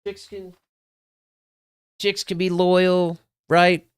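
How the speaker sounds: very high, strained fake approval; squeaky, unconvincing bigger-guy act; forced magnanimity. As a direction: pretend you're fine with it, pitch way up. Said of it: "I tried to be the bigger guy".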